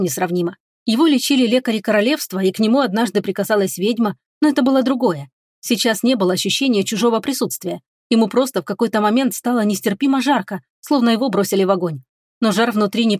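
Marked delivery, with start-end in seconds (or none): none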